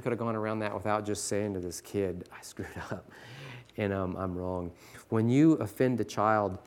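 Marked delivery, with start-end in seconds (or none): 2.3-4.7 soft whispered confession. Acted. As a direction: whisper the admission sheepishly. Teacher admits fault to child.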